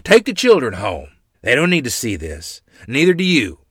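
loud, firm orders; stern holler. A narrator's reading. Grandfather sending mother and children home, sternly hollering. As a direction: send her home in a stern voice. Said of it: "Grandfather hollered in stern voice".

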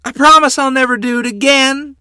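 high, loud desperate pleading; begging. A narrator's reading. Boy promises father before a whipping, begging loudly. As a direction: cry out the promise in panic. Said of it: "I begged".

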